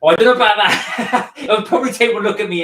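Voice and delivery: laughing it off, high and loud. Real, not acted. Host laughs off the man who messaged her, laughing loudly. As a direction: burst out laughing as you brush it aside.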